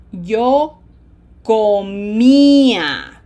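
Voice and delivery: comía with a closed O, slow and loud. Shown as a demonstration. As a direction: round the O tightly in comía. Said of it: "closed O".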